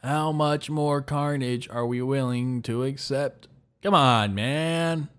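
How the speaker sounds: half-hearted Joe Biden imitation that gives up at the end; a light, clumsy presidential voice. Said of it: "I'm gonna read this in the Biden voice".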